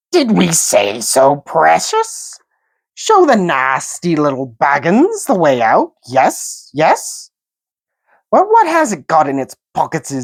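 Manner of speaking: high, slow, sly creature whine; sly Gollum voice. A narrator's reading. Gollum stalling Bilbo about his promise. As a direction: wheedle and stall.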